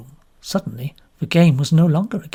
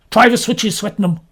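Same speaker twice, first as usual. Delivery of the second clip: commanding colonel; 5 semitones higher, 4 dB louder than usual. A narrator's reading. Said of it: "rose to the occasion".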